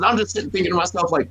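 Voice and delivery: normal talking voice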